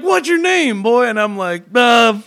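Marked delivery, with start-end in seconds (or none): 0.0-1.1 high, loud mock interrogation; gruff angry man. 1.7-2.2 high, loud hesitant answer; nervous kid. Retold or imitated. Kid voicing himself in story.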